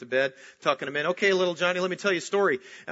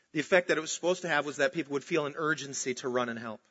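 ordinary talk

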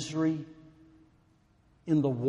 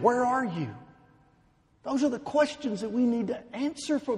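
urgent preaching; 7 semitones higher than usual. Acted. Preacher asking the congregation, at full force. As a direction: press the questions home.

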